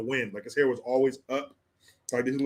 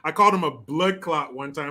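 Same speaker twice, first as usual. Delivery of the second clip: ordinary talk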